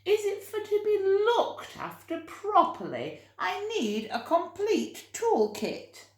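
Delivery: put-on high, fussy old lady deciding; hammed-up prim lady; Mrs Armitage. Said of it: "said Missus Armitage to herself".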